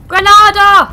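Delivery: loud, high, slow call. Real, not acted. Throwing a grenade, loud high call. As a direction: shout it as you throw.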